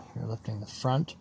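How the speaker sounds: ordinary talk, nothing marked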